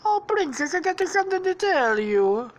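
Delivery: high, singsong cartoon suitor; Mario. Suitor addressing the princess, high cartoon voice. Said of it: "Mario says".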